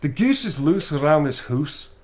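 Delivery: a Scottish accent demo. Shown as a demonstration. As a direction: show the Scottish accent again. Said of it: "Kind of".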